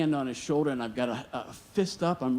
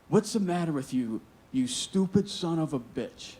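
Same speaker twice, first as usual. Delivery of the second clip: retold angry swearing; 4 semitones higher than usual. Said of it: "he's swearing at me".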